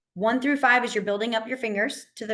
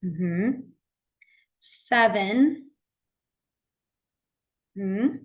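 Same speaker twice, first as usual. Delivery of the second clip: slow, spaced-out counting; walk the class through slowly; teacher drilling six through eight slowly; much slower than usual. Shown as a demonstration. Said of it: "let's do our six through ten again".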